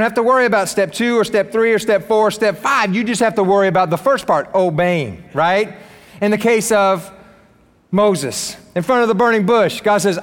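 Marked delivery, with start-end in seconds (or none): none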